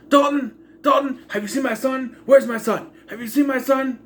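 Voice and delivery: high-pitched imitation of frantic calling out; mock yelling. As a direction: call out loudly for a missing son, mocking it. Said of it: "you're yelling at everyone".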